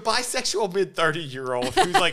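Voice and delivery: loud, high, laughing delivery; cracking up. Real, not acted. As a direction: barely get the pitch out through real laughter. Cracking up pitching a movie idea.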